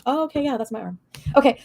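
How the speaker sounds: ordinary delivery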